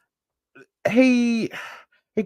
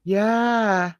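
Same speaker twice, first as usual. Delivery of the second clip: ordinary talk, nothing marked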